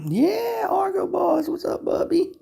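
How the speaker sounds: very high falsetto pet voice. Real, not acted. Owner greeting his cat Argo in very high baby talk.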